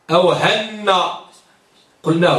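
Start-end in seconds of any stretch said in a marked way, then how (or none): 0.3-0.9 هن said as "hannaa", with an a and doubled n; a vowel, doubled n. Shown as a demonstration. Teacher showing another rejected form, هن as "hannaa". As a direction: say هن as "HAN-naa". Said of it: "لم نقل".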